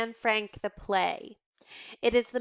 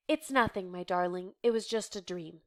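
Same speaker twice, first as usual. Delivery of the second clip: tender comfort; 2 semitones lower, 5 dB quieter than usual. Acted. Mother comforting after nightmare.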